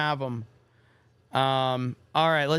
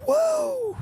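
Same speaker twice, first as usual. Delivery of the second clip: strained, much higher hoarse Ric Flair woo. Retold or imitated. Streamer attempts a Ric Flair woo, hoarse and cracking. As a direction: squeeze out a cracking wrestler's woo. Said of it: "It's so weak".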